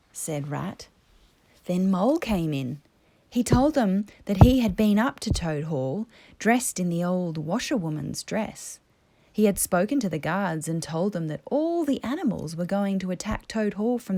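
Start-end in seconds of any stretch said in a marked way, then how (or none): none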